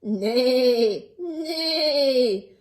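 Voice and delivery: loud neigh with a laugh in it; whinny like a horse. Acted. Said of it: "all she heard was".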